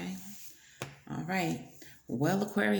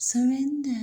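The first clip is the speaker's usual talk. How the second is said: higher, lilting surrender; mock sing-song; 7 semitones higher than usual. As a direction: croon it up high. Playful high sing-song.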